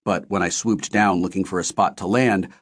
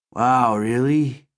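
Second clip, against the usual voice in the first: smiling faintly; 3 semitones higher, much slower than usual. Acted. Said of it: "smiling faintly".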